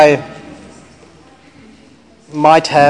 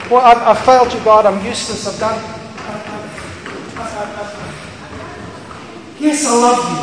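broken confession; 5 semitones higher than usual. Acted. Peter voiced, broken confession.

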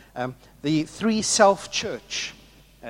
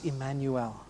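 usual speaking voice

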